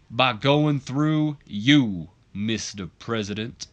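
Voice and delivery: hammed-up king voice, slowing on the big lines. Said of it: "A K A [name] kind of impersonator gimmick".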